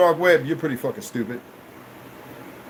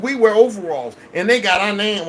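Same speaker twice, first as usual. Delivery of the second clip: ordinary delivery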